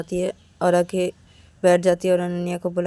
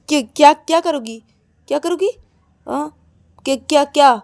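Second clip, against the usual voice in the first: higher, loud confrontational questions; angry challenge; 5 semitones higher, 7 dB louder than usual. Acted. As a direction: throw the question back sharply. Acted challenge back in quarrel. (गुस्से में चुनौती देते हुए)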